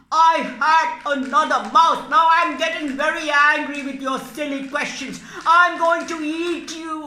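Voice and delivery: loud, fast irritated cat; angry cat. Acted. Cat puppet fed up with the mouse's questions, loud fast anger. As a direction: lose patience and snap.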